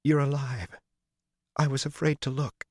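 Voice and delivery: a woman waking to find him alive; Min, relieved. Acted. Min voiced, waking after the explosion.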